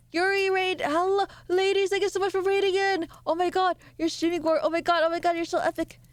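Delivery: high-pitched, excited welcome; excited greeting. Real, not acted. Streamer thanking incoming raiders, thrilled and high-pitched.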